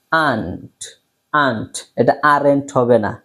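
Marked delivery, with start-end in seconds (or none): 0.1-1.7 aunt said slowly, open a and soft t; pronounced aunt. Shown as a demonstration. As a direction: repeat aunt clearly for students. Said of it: "try to pronounce with me". (aunt ধীরে স্পষ্ট উচ্চারণ)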